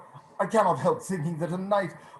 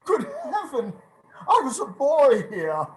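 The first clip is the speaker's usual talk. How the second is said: high, laughing cry of wonder; amazed delight; 11 semitones higher, 6 dB louder than usual. Acted. Scrooge recognizing his boyhood road, amazed. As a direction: gasp with joy at the memory.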